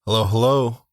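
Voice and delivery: light put-on dad greeting; a hint of his father's voice; imitating his dad. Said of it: "I try to do an impression of my dad".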